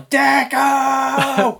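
long high call up at the windows; shouting a name. Retold or imitated. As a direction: yell the name up to the flats. Calling up to apartment windows.

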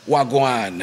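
low-pitched Jamaican patois greeting; Jamaican patois accent. Shown as a demonstration. Guest demonstrates Creole speech to the hosts, Jamaican patois accent. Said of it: "Cuando yo digo".

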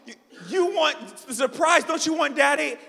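loud, high, playful protest; mock-indignant daddy. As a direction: act out a wounded dad, hammed up. Acting out mock-hurt father.

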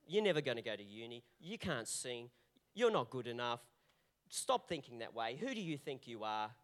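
low, soft voice of the accuser putting you down; enemy's taunting voice. Acted. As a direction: drop low and sneer them down like the enemy. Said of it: "They come with voices".

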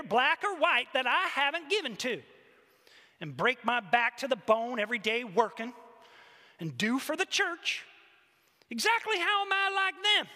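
loud, high indignant tirade; acted rant, punching the words; growled grievance. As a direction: argue with God, wronged and self-righteous. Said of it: "she growled".

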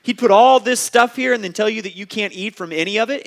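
the serpent stirring up Eve, in loud mock outrage; Satan's fake shock. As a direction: ham up the serpent's fake indignation. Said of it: "the fake kind of shock that he's creating".

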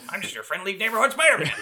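high, fast hammed-up J. Jonah voice; a high quick parody of the Spider-Man catchphrase; Jonah Jameson as Spider-Man. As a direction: chirp the tagline like a gruff editor playing hero. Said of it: "Can you imagine just Spider-Man".

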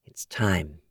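soft, low murmur. Acted. Tom alone in his flat, murmuring softly. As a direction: say it quietly to himself. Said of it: "he murmured".

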